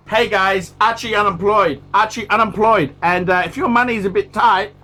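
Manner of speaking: punchy promo voice, pushed loud; loud ad read. Acted. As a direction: sell the pizza deal like a hype ad. Sponsor-style plug at the end.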